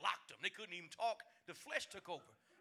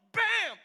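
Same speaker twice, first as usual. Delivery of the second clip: shouted crash sound effect; loud bam; 22 dB louder than usual. Retold or imitated. Preacher acting out the kid's crash, a loud bam. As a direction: slam the bam like a fall.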